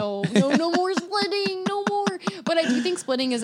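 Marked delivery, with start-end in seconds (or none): 0.0-2.2 pleading listeners. Acted. Co-host playing listeners sick of splitting, mock plea. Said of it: "People, people listening going".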